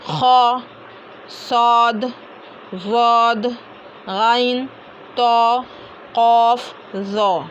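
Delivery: ख, सौद, वद, गैन, तो, कौफ, ज़ो each full and rounded, towards "aw"; one held, heavy letter at a time, ख to ज़ो; full-mouth heavy letters. Shown as a demonstration. Teacher modelling the always-full letters, heavy recitation. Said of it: "इन हरूफ को हमेशा पुर पढ़े".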